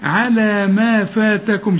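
على ما فاتكم stretched slowly with a light ala; slow correct recitation. Shown as a demonstration. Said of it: "قلتها صحيحة".

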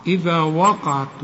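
إذا with the tongue out on the ذ, وقعت with a heavy qaf, slowly; the right way to say وقعت; correct tajweed. Shown as a demonstration. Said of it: "وبعدين القاف مفخمة".

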